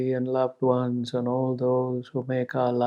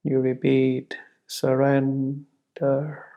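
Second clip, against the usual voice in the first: ordinary talk, nothing marked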